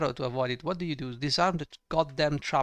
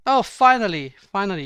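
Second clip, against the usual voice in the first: sarcastic outburst; 4 semitones higher, 9 dB louder than usual. Real, not acted. Sarcastic relief at failed save.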